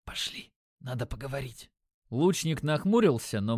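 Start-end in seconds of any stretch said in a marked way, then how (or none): 0.1-1.7 very soft conspiratorial whisper. A narrator's reading. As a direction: whisper it, barely voiced. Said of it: "шепнув". (шёпотом)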